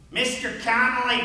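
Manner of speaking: distinctive coach voice. Retold or imitated. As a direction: imitate the teacher singling out a student. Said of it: "in that very distinctive voice".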